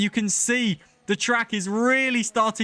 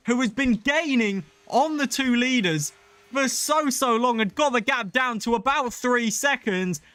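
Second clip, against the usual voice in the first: higher-pitched, urgent race commentary; excited play-by-play; 6 semitones higher than usual. Real, not acted. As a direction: call the sudden incident as it happens. Live race call of crash.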